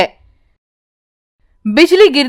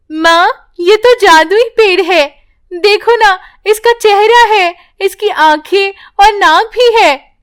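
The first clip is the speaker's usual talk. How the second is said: put-on higher, louder child in wonder; amazed little girl; 8 semitones higher, 3 dB louder than usual. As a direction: point out the magic tree excitedly.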